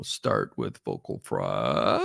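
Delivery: slow low rumble, as the teacher showed it; imitating trainer's fry. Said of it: "he would say".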